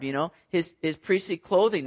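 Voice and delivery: usual speaking voice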